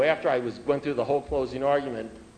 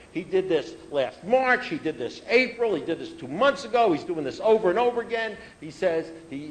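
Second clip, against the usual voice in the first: higher pitched, indignant tirade; retold righteous rant; heated rant; 6 semitones higher, 3 dB louder than usual. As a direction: tear into the guy, all worked up. Closing argument against Bobby continues.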